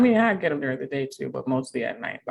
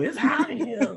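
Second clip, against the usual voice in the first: high, laughing imitation of mother; mother impression; 8 semitones higher, slower than usual. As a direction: mimic your mother's complaint, high and amused. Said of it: "just sound just like her".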